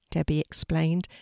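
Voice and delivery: plain reading voice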